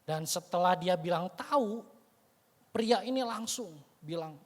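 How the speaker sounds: ordinary talk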